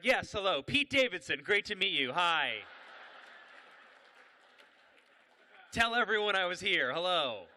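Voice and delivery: loud, showy hello; hammed-up star greeting; celebrity greeting. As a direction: announce yourself loudly to adoring fans. Recognised intruder greets them like fans.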